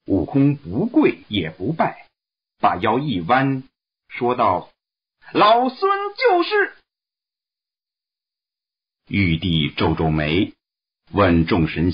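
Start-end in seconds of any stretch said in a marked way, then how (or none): 5.3-6.7 high, brash monkey voice; cocky Monkey King. Acted. Monkey King boldly answering the Jade Emperor, high cocky voice. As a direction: answer the emperor with cheeky pride.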